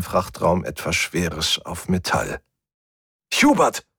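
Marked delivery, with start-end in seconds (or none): 3.3-3.9 high, urgent shout of a name; alarmed call. A narrator's reading. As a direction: cry out for a friend. Calls out to endangered friend. (erschrocken gerufen)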